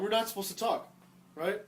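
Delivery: normal talking voice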